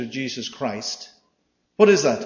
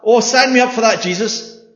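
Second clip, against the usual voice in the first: mock a keen recruit signing up; mock eager volunteer; 7 dB louder than usual. Mocking eager would-be follower.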